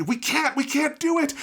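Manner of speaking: hammed-up cornered villain panicking; mock panic. Villain imagined fleeing the cannon, mock panicked cry. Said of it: "What if instead it's like".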